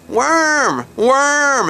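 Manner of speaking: high-pitched imitation of a wailing toddler; screaming toddler. Storyteller imitating little Willie outside the camper, high mock scream. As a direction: draw each cry out high, mimicking a small boy. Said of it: "and just screaming".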